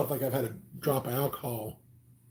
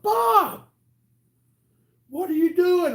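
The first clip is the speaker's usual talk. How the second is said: very high, loud startled yell; surprised shout; 6 dB louder, slower than usual. Retold or imitated. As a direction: yell across the yard in alarm. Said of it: "I'm like".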